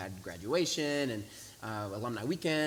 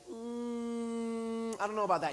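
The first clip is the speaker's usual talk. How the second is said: long high hum, then doubtful; sceptical hesitation; 9 semitones higher, slower than usual. Retold or imitated. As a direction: hum and balk at the request.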